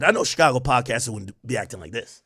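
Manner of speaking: ordinary talk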